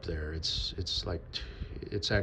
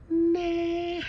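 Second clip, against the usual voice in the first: very high, drawn-out mock squeal; squeaky dismissal; 4 dB louder than usual. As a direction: brush it off in a silly high voice. Dismissing effort with comic high squeal.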